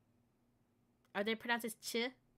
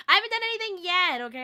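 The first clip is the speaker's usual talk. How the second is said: indignant protest; 9 semitones higher, 14 dB louder, faster than usual. Real, not acted. Defending herself to chat.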